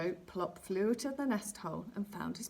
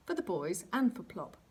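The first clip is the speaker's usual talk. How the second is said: plain reading voice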